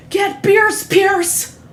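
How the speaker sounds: high-pitched, hammed-up yelp; comedian's bit impression. Retelling a comic's bit about asking Pierce for beers, silly high impression. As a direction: call to a friend in a silly high voice. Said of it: "This is my impression of a guy".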